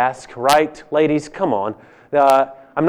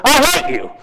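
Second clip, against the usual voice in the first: hammed-up shout of hate, pitched way up; yelled at the top of the lungs; 10 semitones higher than usual. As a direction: belt it out so the whole church hears. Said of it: "at the top of my lungs, let me proclaim it to the entire church".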